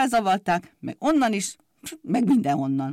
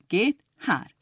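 usual speaking voice

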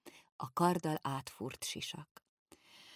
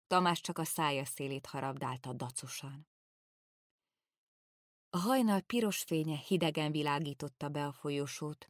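ordinary delivery

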